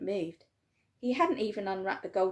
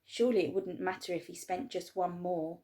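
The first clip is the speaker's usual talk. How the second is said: ordinary delivery, nothing marked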